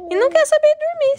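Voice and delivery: high, loud and fast. Real, not acted. Playful complaint to baby. (voz de bebê)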